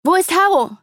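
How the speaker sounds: loud, high-pitched urgent call; gerufen. A narrator's reading. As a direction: call out the question, voice jumping up high. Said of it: "rief ich". (laut und hoch gerufen)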